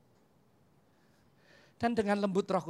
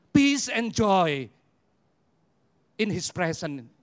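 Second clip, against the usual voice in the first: slow emphatic; 5 dB louder, much slower than usual. Real, not acted. Preacher savours words.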